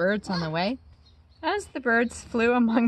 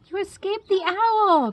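high, louder joyful cry; young bat crying out to her found mother; excited cry; 11 semitones higher, 5 dB louder than usual. A narrator's reading. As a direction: call out in happy relief. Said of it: "cried Stella Luna".

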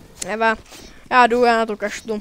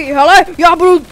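1 excited shout; 3 semitones higher, 9 dB louder than usual. Real, not acted. Excited gamer yelling at teammate.

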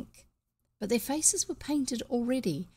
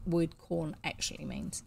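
usual speaking voice

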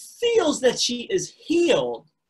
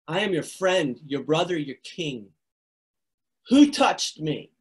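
sincere Jesus; 4 semitones lower than usual. Acted. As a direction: speak as a friend, not a machine. Jesus voiced, sincere declaration.

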